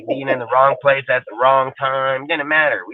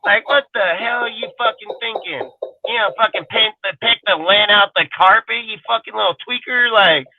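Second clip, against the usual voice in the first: raised, higher angry rant; retold angry yelling; 7 semitones higher than usual. Telling off the friend picking lint from the carpet, angrily. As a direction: chew him out at full tilt.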